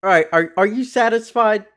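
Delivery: impatient high push; are you done yet, loudly; exasperated. Real, not acted. Impatient to move on.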